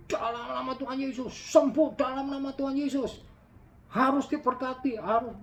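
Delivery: high-pitched, forced preacher yelling at God; mock shouted prayer. Preacher mimics a too-serious believer shouting in prayer, high hammed-up yell. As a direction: ham up an over-earnest believer shouting his prayer. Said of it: "teriak-teriak dengan suara yang keras-keras".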